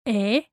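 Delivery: E said as "eh"; a pure Spanish vowel "eh" for E. Shown as a demonstration. Teacher isolates the vowel, E said as "eh". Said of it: "Remember to pronounce the E".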